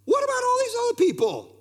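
high, loud disbelieving cry; incredulous outburst. Acted. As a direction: throw the question out in disbelief, "all" stressed. Preacher's rhetorical outburst.

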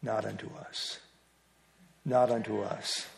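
a wife's quiet, knowing rebuke; wife's quiet reply. Retold or imitated. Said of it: "She just looked at me and she said".

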